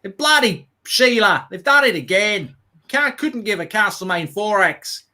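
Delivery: high, slow mock Aussie moan; Australian accent. Mock Australian fan grumbling about England. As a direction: ham up a grumpy Aussie bloke. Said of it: "They'll be the same as well".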